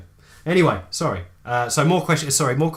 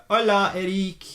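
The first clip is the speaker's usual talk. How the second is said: mock Spanish; 6 semitones higher than usual. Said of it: "I just wanted to say".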